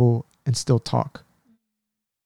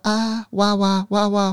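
lightly voiced toddler babble, higher; baby asking for water; 8 semitones higher, slower than usual. Uncle imitating his niece asking for water. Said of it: "She'll be like".

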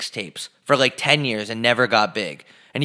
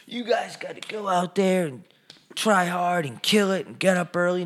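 mocking hype voice; 7 semitones higher, 4 dB quieter than usual. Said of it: "Follow two kids that are just like".

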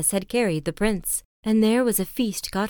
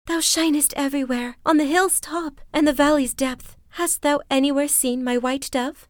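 higher, imploring heroine; Lily, higher pleading; 7 semitones higher than usual. A narrator's reading. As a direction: beg the sun for help.

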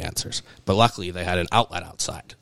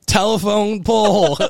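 mock panic; 12 semitones higher, 3 dB louder than usual. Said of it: "I'm like".

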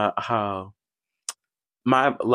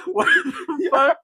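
laughing disbelief; 12 semitones higher, slower than usual. Real, not acted. Laughing disbelief, slow high.

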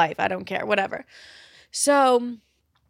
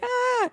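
mock panic gasp. Panic attack sound imitated.

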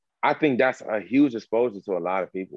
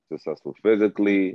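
usual speaking voice

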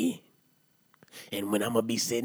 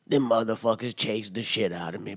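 ordinary talk, nothing marked

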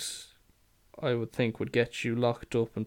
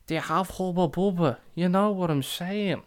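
put-on higher-pitched kid voice; mimicked kid; 6 semitones higher than usual. Kid repeats his question to the confused shop worker, mimicked kid voice. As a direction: imitate a kid repeating himself. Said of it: "this kid rocks up".

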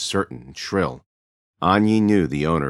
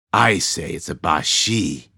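slow, ominous verdict; slower than usual. A narrator's reading. Ba Lo pronounces his verdict.

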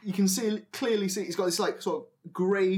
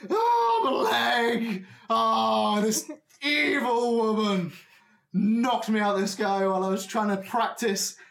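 loud, high wailing with pain; melodramatic goblin; 6 semitones higher, 3 dB louder than usual. Acted. Old goblin making a huge deal of his broken leg, melodramatic wailing. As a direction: wail like a hammed-up injured acrobat. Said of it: "making the most dramatic sort of, like, deal".

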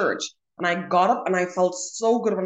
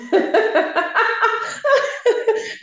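high, rapid cackling laugh; loud high-pitched laughing fit; burst of laughter; 4 dB louder than usual. Real, not acted. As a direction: crack up in a long high laugh. Laughs at the other's realisation.

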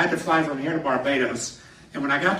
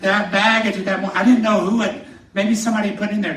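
panicked laughing; 4 semitones higher, 6 dB louder than usual. Retold or imitated. Recalling panic about planted drugs.

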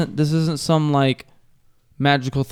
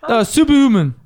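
high, loud, drawn-out announcing impression; mimicked hype shout; stuntman catchphrase; 11 semitones higher, 6 dB louder than usual. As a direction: belt it out high and slow like a stunt showman. Imitating an internet stuntman's catchphrase.

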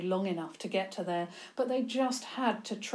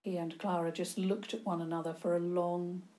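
plain reading voice

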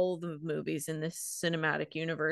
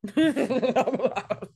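whispery laugh; 4 semitones higher, 4 dB louder than usual. Real, not acted.

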